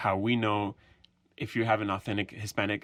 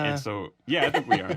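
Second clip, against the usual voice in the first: louder, higher, laughing as you speak; amused; 5 semitones higher, 5 dB louder than usual. Real, not acted. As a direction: laugh through a half-confident assurance. Laughs while defending the plan.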